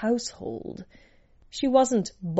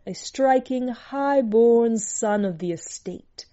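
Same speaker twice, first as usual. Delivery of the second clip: plain reading voice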